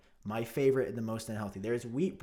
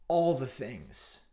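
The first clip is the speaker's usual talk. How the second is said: slow, dragged-out all the things; a lecturer trailing off into all the things; mock professor drawl; much slower than usual. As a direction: stretch all the things out lazily. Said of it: "it'd be like".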